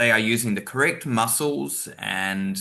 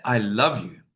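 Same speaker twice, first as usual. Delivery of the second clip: "love" stressed, with a falling tune; emphatic "love", as if really meaning it. Shown as a demonstration. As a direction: lean on the word "love". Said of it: "but if I say it".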